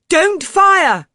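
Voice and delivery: loud, high shout; crying out. A narrator's reading. Narrator lunging at Crompton, shouting at him. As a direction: stop him before he shoots. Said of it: "I cried".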